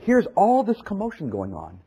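ordinary delivery

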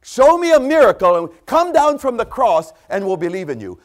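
high, loud jeering demand; taunting mockers. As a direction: shout the dare up at the cross.

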